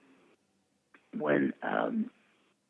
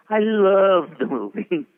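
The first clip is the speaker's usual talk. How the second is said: higher, louder, slow declaration; wife's emphatic reply; 7 semitones higher, 9 dB louder, slower than usual. Retold or imitated. Wife telling her husband she loved the film, higher, louder and slow. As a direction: draw it out big and high.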